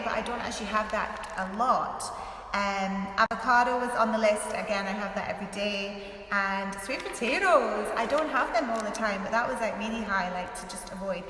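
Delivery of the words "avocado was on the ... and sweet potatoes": ordinary talk, nothing marked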